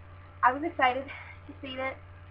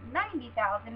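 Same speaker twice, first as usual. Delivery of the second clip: ordinary talk, nothing marked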